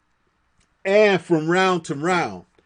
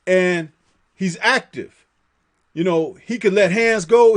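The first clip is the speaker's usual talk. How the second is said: ordinary talk, nothing marked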